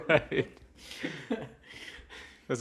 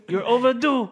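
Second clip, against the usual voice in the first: much higher, laughing put-on French accent; mock French accent; 13 semitones higher, 9 dB louder than usual. Cohost joking about an overdue password, in a mock French accent. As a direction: sneer it like a snooty French clerk. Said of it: "You both went French".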